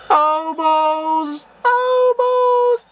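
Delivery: very high startled oh balls; startled cry. Real, not acted. Gamer jumped by the ghost, high startled cry. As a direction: yelp it in fright.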